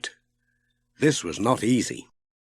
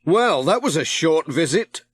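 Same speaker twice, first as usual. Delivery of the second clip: Pat wry; 5 semitones higher than usual. A narrator's reading. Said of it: "said Pat".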